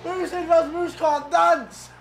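ordinary delivery

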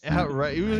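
normal talking voice

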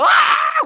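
sudden high yelp; hammed-up cry of shock; sharp yelping cry; mock scream. Acting out a seizure scream.

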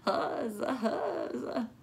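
put-on raspy, giggly old woman cheer; lightly voiced raspy grandma; raspy Gram voice. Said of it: "she said in her raspy voice".

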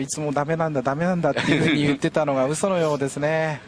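ordinary talk

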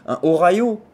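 "Ohio" said "o-ra-yo" with a French R. Shown as a demonstration. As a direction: swap the H in "Ohio" for a throaty R. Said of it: "faut le prononcer comme un R à nous". ("Ohio" avec un R à la française)

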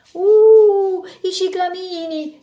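high, loud delighted cry, then slow; joyful exclamation. Acted. Lady on the bus spotting cyclamens, delighted cry. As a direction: gasp with glee at the flowers. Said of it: "esclamò una signora tutta giuliva".

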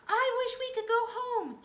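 high, very loud wish; desperate shout. A narrator's reading. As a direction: shout it out at full pitch. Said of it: "shouted Annie".

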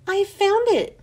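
put-on a kid thrilled to find it; excited exclaim. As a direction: call out the find with delight. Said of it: "Mauricia exclaimed".

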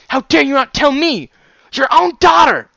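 very high, full-volume outraged yell; furious outburst. Acted. Daughter raging that father kept secrets, furious screaming. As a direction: scream the accusation, pitch soaring.

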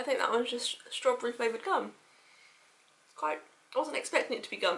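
muffled through fingers; chewing behind her hand. Real, not acted. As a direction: mouth full, hand covering. Seen 1: Hand covers mouth while chewing; lowered briefly at 3.3.

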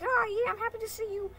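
high, quick, gushing cartoon voice; happy bow character. Stickwoman's bow saying it is happy, gushing. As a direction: gush it fast and high.

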